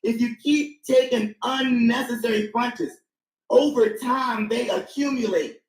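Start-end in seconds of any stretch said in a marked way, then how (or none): 0.0-2.9 slow, unnecessary stretched out; stress on unnecessary. Real, not acted. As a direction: spell out unnecessary with weight. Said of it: "emphasis on the word unnecessary".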